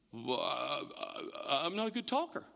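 soft, halting, hesitant mumble; meek stammer. Acted. Moses excusing himself after God's outburst, meek soft stammer. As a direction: shrink back and falter softly.

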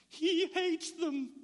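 put-on high, slow childish protest; lightly voiced young girl; girl's voice. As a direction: imitate the scared girl insisting. Girl voiced quoting her dad.

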